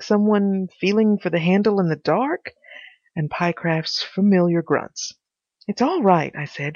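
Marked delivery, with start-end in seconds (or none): none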